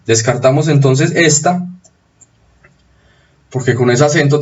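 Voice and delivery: ordinary delivery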